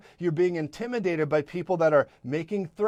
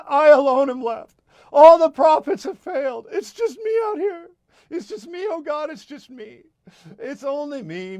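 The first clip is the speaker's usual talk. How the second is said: high, loud desperate wail; Elijah crying out; 10 semitones higher, 6 dB louder than usual. Retold or imitated. Elijah alone in the desert, crying out high. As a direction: lament like a lone prophet in the desert. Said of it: "he was crying out".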